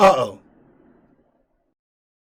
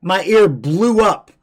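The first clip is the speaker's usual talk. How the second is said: usual speaking voice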